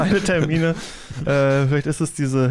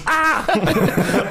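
startled waking scream; 7 semitones higher than usual. Retold or imitated. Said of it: "Augen aufreißt und so".

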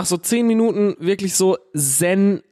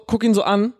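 normal talking voice

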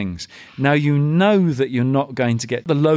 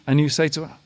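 ordinary talk, nothing marked

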